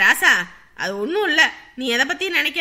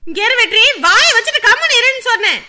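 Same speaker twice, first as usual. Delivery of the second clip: loud, high angry order; stern scolding; 5 semitones higher, 6 dB louder than usual. Acted. Mother ordering son to shut up, stern scolding. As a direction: silence them with a shout.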